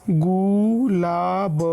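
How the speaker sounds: Gulab stretched very slowly, gu and lāb held; sounded out slowly. Shown as a demonstration. Teacher showing how to read it, gulab very slow by syllables. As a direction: draw out each syllable of gulab. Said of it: "इस तरीके से आप इसको पढ़ेंगे".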